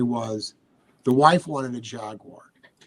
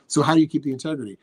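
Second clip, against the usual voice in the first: ordinary delivery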